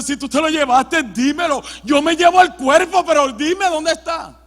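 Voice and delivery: loud, high acted plea; desperate demand. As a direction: demand an answer as a distraught woman. María exige el cuerpo al hortelano. (súplica desesperada, aguda y fuerte)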